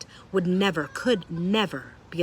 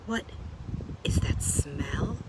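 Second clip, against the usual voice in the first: slow hushed whisper, sniffing the air; slower than usual. A narrator's reading. Roscuro sniffing the mouse, slow whisper. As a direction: breathe it out as you sniff. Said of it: "he asked".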